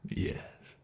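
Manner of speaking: a soft, voiceless yes; gloating whisper. Acted. Captor confirming the reversed chase, whispered.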